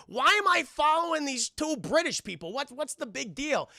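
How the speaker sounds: high-voiced complaint; mock exasperation. Viewer questions following two British people, high mock exasperation. Acted exasperation at watching the tape.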